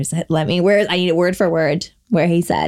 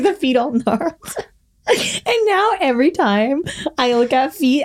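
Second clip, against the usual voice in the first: ordinary talk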